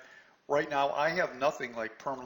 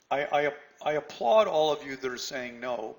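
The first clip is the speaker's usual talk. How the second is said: ordinary talk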